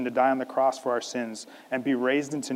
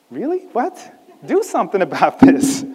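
high, incredulous outburst, getting louder; exasperated father; 6 semitones higher, 8 dB louder than usual. Acted. Father to Jesus over his convulsing boy, exasperated. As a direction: act out a father at his wits' end. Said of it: "the father's exasperation".

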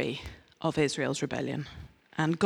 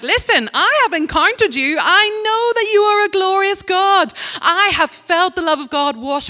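much higher, louder fervent cry; passionate preaching; 13 semitones higher, 7 dB louder than usual. Real, not acted. Preacher crying out to God, louder fervent declaration. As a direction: declare it with full fervour.

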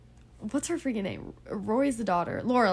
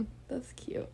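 whisper; 2 semitones higher, 13 dB quieter than usual. Real, not acted. Soft aside while co-host talks.

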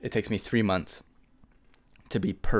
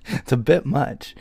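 amused laughing remark; 9 semitones higher, 7 dB louder, slower than usual. Real, not acted. Laughing at own morbid story.

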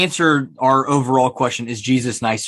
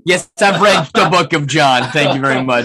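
mock indignant; 3 semitones higher, 5 dB louder than usual. Mock-indignant reply to "have you read.

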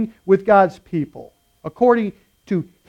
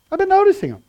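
much higher, gushing; even more excited; 12 semitones higher, 4 dB louder, faster than usual. Shown as a demonstration. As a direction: climb higher in wonder. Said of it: "Yeah, I've been noticing 'em".

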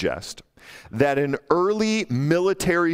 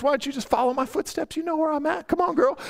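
high-pitched flirty put-on voice; playful teasing; 13 semitones higher, faster than usual. Solomon answering the wife who wants him, high and teasing. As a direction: coax her along, teasing, way up high. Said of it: "he's teasing, he's playing, he's having a little fun".

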